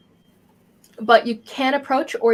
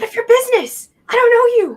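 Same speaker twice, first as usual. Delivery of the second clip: put-on high, loud child voice; defensive kid; 13 semitones higher, 8 dB louder than usual. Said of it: "This kid seems to be maybe a little bit younger".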